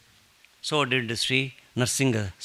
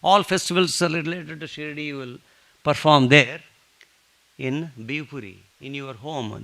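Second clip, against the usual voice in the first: firm command; 4 semitones higher, 6 dB louder than usual. Retold or imitated. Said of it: "putting my right foot down".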